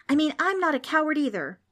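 high, loud, insistent voice; shouted protest. A narrator's reading. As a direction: insist loudly, pitched high. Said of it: "Frank suddenly shouted".

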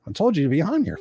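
ordinary delivery

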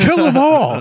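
very high, loud mock war cry; battle cry. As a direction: holler it like a bloodthirsty crowd. Acting out cheering on the slaughter.

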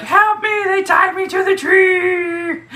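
very high shouted plea, drawn out slow; sister crying for help. Retold or imitated. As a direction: yell for rescue like a kid. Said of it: "my sister's like".

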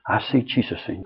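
soft, tender "I see Jesus in you"; gentle quiet blessing. Pretend. Parent tenderly blessing a wayward child, softly. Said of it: "what if you looked at him and said".

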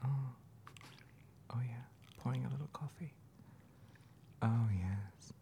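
soft, breathy ASMR murmur; mock ASMR whisper. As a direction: whisper close to the mic like an ASMR video. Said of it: "A S M R".

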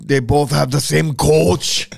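slow, hammed-up mock Scottish accent; a jokey stab at a Scottish accent. Teasing about two fighters, mock Scottish accent. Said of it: "Got more of a Northern Ireland".

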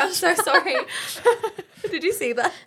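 embarrassed laughter; laughing. Real, not acted. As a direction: laugh through the apology. Laughs after dribbling.

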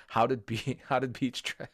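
laughing through the question; trying not to laugh. Real, not acted. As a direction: break up laughing as you say it. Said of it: "I can't say the title without laughing".

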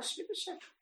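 a hint of high, quick self-scolding; talking to herself. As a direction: tell yourself to sit down. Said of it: "אני אומרת לעצמי".

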